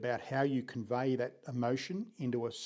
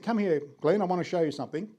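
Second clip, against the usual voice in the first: put-on higher, louder English headmaster accent; imitated English accent; 5 semitones higher, 5 dB louder, faster than usual. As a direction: call a pupil over in an imitated English accent. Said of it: "And this is how we spoke".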